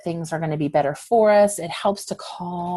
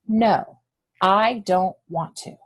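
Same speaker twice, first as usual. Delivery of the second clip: firm, slow no without yelling; assertive; slower than usual. Shown as a demonstration. Teacher showing students a convincing refusal, assertive and firm. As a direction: refuse strongly, like you mean it. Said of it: "I do have to be assertive".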